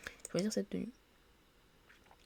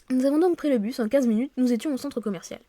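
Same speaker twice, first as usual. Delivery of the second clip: animated read-aloud narration; story narration; 6 semitones higher, 9 dB louder than usual. Acted. Player narrating a colleague's reluctant move, animated story reading. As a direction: narrate the scene with a lift in energy.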